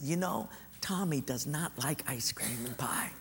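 sneaky whispered aside; sly whisper. Acted. Sister whispers to grandpa about brother. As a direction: hush it like a secret.

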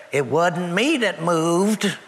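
laughing through a slow quote. Retold or imitated. Harry joking to the car, laughing. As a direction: chuckle out the line slowly. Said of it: "and he said".

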